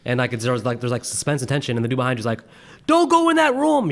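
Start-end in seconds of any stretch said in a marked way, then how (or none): 2.9-3.9 high, slow mock warning; moviegoer's heckle. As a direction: holler advice at the screen, high-pitched. Imitates moviegoer yelling at the screen.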